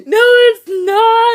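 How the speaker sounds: very loud, high, drawn-out mock wail; breaking into sobs. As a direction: act out breaking down in tears. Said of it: "And I broke".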